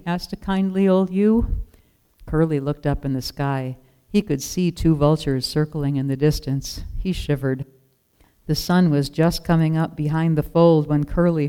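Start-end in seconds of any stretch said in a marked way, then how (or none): none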